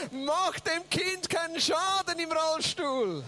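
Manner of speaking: slow, high, drawn-out call; mock scolding yell. Interpreter as wife to sons, slow high holler. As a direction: stretch every word as a warning. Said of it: "meine Frau schreit ihnen her".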